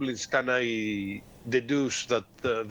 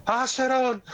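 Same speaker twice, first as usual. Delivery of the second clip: shout; 11 semitones higher than usual. Acted. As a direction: yell down the corridor. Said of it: "and I shout".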